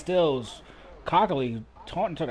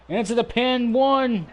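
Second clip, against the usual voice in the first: much higher, louder pin count; wrestling commentator; 9 semitones higher, 6 dB louder than usual. Acted. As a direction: count it like the final bell.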